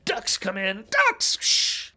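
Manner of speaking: loud, high burst on the ducks; mock jump-scare outburst. Film fan retelling ducks flying at viewer, mock jump-scare shout. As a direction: shout it like a sudden scare, ending in a loud shush.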